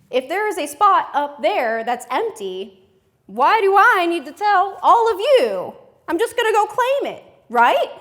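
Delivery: loud, high-pitched cocky declaration; entitled male driver. Acted. Comic voicing men who don't use turn signals, loud and entitled. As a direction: announce it big, like the road is yours. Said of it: "what goes through their mind".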